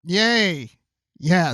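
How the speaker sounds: ordinary talk, nothing marked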